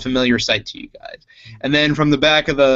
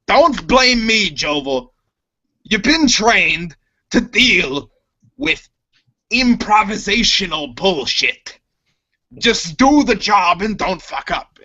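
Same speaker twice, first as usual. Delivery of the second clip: high, raised berating voice, slow; scolding; 7 semitones higher than usual. Acted. Chopper scolds Jovel, high and angry. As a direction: chew him out. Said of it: "And he goes".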